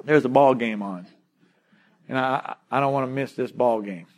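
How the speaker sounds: low, softened mock excuse-making; reluctant son's excuse. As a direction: lightly act a son sheepishly begging off. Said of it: "you're gonna wake up and say".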